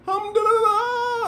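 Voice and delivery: very high, drawn-out put-on cry; exaggerated high-pitched mock greeting; mock foreign talk. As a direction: stretch it out high like a hammed-up imitation. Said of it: "start talking all that dumb stuff".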